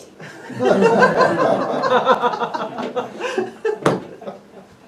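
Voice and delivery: a long high peal of laughter. Real, not acted. After the cop lets her go, long loud laughter. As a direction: let the laugh roll on.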